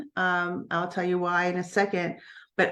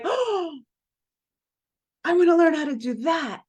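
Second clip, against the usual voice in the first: thrilled discovery, voice shooting up; excited outburst; 9 semitones higher than usual. Retold or imitated. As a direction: burst out with sudden eagerness. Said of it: "And I'm like".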